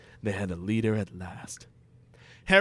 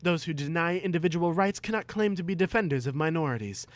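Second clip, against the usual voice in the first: rapid high sermon; speechify fast and lofty; fast preaching Harry, higher; 5 semitones higher, faster than usual. Acted. Harry lecturing Voldemort, fast and high.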